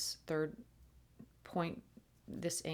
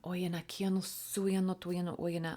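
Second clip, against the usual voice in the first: whispered light language. Real, not acted.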